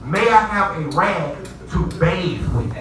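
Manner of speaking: very slow, careful request; slow polite. Retold or imitated. Guest explaining to the manager, very slow and careful. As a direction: spell it out slowly for the manager. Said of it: "I said".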